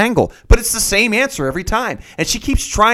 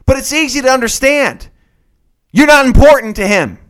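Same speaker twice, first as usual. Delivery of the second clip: loud, higher, emphatic lecture; blunt scolding; 5 semitones higher, 7 dB louder than usual. Acted. Podcaster setting the climber's girlfriend straight, blunt scolding. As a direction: hammer each point home loudly.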